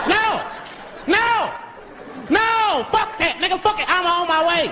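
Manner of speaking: very high-pitched comic cries of alarm; frantic mock outburst. Driver reacting to phone call, frantic and squealing. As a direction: squeal each refusal, then rush to the rescue.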